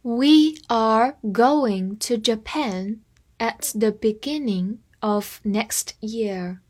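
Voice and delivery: half-speed careful reading; deliberate classroom pace; slowed down. Shown as a demonstration. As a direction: read it slowly, word by word. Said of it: "我们放慢速度".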